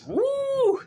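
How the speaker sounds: sudden high whoop of excitement; excited whoop. Real, not acted. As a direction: let out a whoop at a line that hit home. Hyped about a real line.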